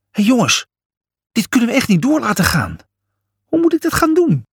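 high-pitched, rising panic; panicked. A narrator's reading. Julius facing ruling a whole country, panicked. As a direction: plead in a high, flustered voice.

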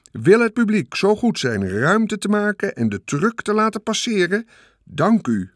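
high, loud public-address voice; fairground announcer. A narrator's reading. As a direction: announce it grandly over the crowd. Loudspeaker announcer at the fair.

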